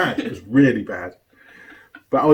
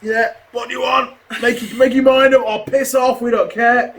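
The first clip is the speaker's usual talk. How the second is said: loud, high, rude brush-off; mock rude waitress, up high and loud; rude cafe staff; 11 semitones higher, 5 dB louder than usual. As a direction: bark at the customer like you couldn't care less. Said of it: "They're like".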